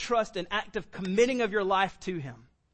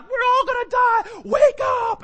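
high, loud terrified yelling; panicked shout; 11 dB louder, slower than usual. Retold or imitated. Disciples wake the sleeping Jesus in a storm, panicked shout. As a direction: scream it like men sure the boat is sinking. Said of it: "they're, like, freaking out".

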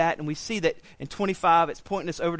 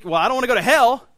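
mock panic; 7 semitones higher, 9 dB louder, faster than usual. Mock panicked believer quote.

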